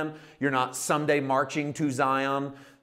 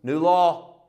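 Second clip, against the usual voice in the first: God decreeing; 5 semitones higher, 9 dB louder than usual. Retold or imitated. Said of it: "God turns around to everyone left living and goes".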